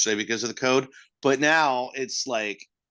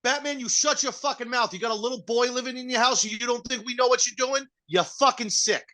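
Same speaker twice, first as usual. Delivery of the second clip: much higher, hammed-up Jersey Italian scolding; mock wiseguy rant; 11 semitones higher than usual. Italian wiseguy berates Batman, high mock rant. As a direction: tear into Batman like an offended uncle.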